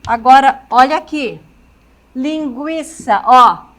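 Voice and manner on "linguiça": slow "Linguiça", the U clearly pronounced; "Linguiça" with U sounded. Shown as a demonstration. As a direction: stretch "Linguiça" so the U is heard. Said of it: "Eu pronuncio o U e eu pronuncio o I".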